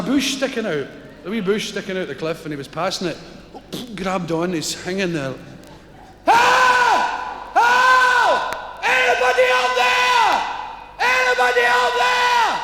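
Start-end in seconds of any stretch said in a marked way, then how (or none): 6.2-12.6 desperate shout. Acted. Man clinging to a bush yelling for anybody, desperate. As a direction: scream it up the cliff face.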